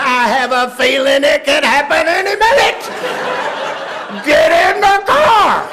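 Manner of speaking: high, full-force yelling; exasperated father. Retold or imitated. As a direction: shout back, building to a bellowed order.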